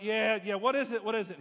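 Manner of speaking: brushing them off, annoyed; frustrated. Retold or imitated. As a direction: worker annoyed at being called to the office. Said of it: "he's just frustrated".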